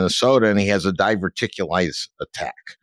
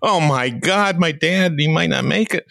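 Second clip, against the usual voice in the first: higher, hammed-up worried wail; mock panic; 7 semitones higher than usual. Son retelling his fear for his dad, mock anguished wail. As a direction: play up his own panic. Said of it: "here I go, like".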